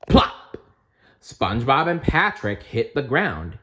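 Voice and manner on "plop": loud unvoiced plop; plop sound. Narrator making the thump of the boys landing, loud plop.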